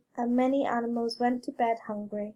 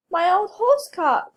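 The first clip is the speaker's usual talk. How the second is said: groaning cry; 6 semitones higher, 8 dB louder than usual. A narrator's reading. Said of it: "groaned Brer Wolf".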